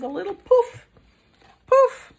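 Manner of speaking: puffed poof, popping up loud; a squeaky little fire poof. Acted. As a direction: pop out a tiny dragon puff. Dragon's weak fire puff.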